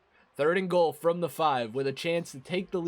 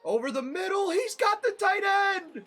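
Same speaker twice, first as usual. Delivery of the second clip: hoarse play-call yell, under the weather; raspy yelling. Real, not acted. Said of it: "Excuse my raspy voice, too, when I'm yelling like that".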